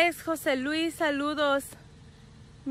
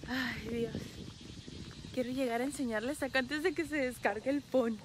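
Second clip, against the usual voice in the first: normal talking voice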